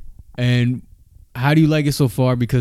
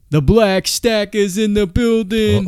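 higher, slow, hyped-up announcement; hype guest intro; 8 semitones higher, 3 dB louder, slower than usual. Acted.

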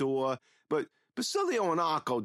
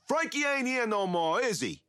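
yelling; 11 semitones higher than usual. A narrator's reading. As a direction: yell it in his face. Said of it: "Basilio yells".

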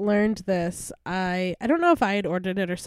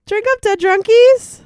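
cheery toast; 13 semitones higher, 11 dB louder than usual. Real, not acted. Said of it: "toast to listeners".

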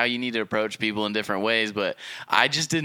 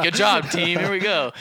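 louder, higher team cheer; hyped cheer; 6 semitones higher, 3 dB louder than usual. Retold or imitated. Celebrating the closed deal with the team, loud and high. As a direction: pump up the team. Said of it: "So, like".

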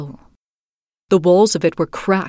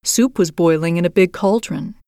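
usual speaking voice

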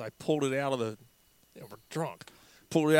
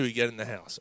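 usual speaking voice

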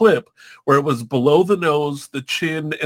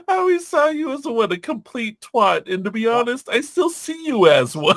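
high-pitched mocking retort; brother imitation; 7 semitones higher than usual. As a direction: pitch it well up for the put-down. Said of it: "then he's like".

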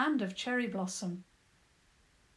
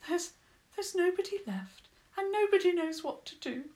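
higher, sob-broken mole voice; mildly sobbing little mole; between sobs; 9 semitones higher than usual. A narrator's reading. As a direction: choke the words out between sobs. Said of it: "he said between the sobs".

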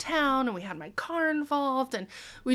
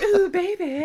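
mock sexy; 10 semitones higher, 8 dB louder than usual. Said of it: "And I'd be like".

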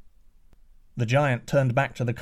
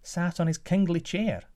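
prince's voice; 7 semitones higher than usual. Acted. Prince answers giant.

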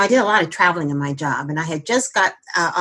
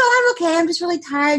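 mock cheerful reassurance, higher; faking fine; 10 semitones higher than usual. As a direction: brush off the worry with forced brightness. Mother pretending she is fine.

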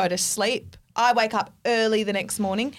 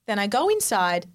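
ordinary talk, nothing marked

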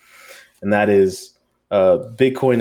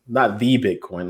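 usual speaking voice

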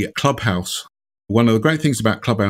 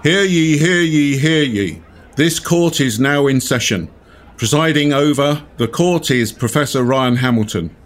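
town crier; 5 semitones higher than usual. Pretend. Mock court opening of debate.

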